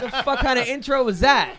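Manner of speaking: retold indignant snap; mock outrage. Comic recalls reacting to his intro, mock outraged. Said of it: "I was like".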